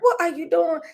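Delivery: high, loud startled question; alarmed sister. Retold or imitated. Sister sees her pick up teeth, high startled squeal. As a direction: squeal it like a shocked little sister.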